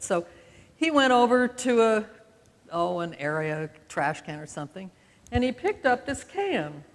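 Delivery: usual speaking voice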